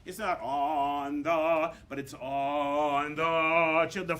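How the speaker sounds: put-on "It's not on the, but it's on the children" drawn out slowly, leaning on "children"; slow and weighty, landing on "children"; stress on children. Shown as a demonstration. Conductor shows choir where phrase goes, slow stressing. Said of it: "Do something".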